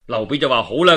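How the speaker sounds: ordinary delivery, nothing marked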